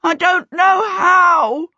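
high, loud, drawn-out wail; long, high cry of despair; panicked wail. A narrator's reading. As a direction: wail it helplessly, stretching the words. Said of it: "Peter wailed".

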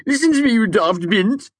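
high, loud posh imitation; Ambrose's accent. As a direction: mimic his haughty snarl. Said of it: "a fair imitation of Ambrose's vintage accent".

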